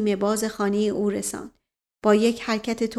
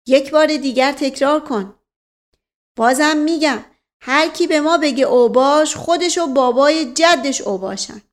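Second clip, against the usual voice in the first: tag; 4 semitones higher, 6 dB louder than usual. A narrator's reading. با چهرهٔ برافروخته.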